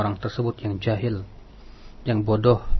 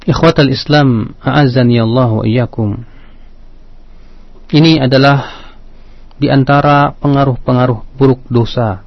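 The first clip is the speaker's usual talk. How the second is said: preaching; 10 dB louder than usual. Acted. Radio sermon delivery.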